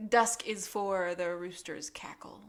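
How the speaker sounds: slow, hushed spy password; mock-mysterious whispered reply; secretive whisper. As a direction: whisper it like a coded message.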